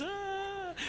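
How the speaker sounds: very high, sustained little "ah"; thin high imitation of the waving Queen; high regal "ah". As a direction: coo a long, high greeting note. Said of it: "comes out again and goes".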